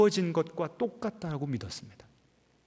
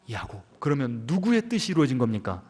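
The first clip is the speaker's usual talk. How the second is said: ordinary talk, nothing marked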